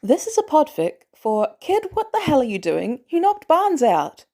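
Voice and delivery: ordinary delivery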